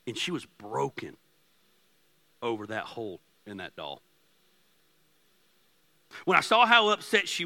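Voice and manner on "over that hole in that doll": ordinary delivery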